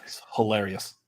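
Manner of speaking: whispered aside; whispering. Real, not acted. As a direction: whisper it under your breath. Aside while partner jokes.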